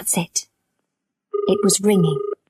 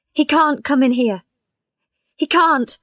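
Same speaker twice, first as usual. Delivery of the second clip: panicked fear; 6 semitones higher, 3 dB louder than usual. Acted. Patient panics at name Tucker.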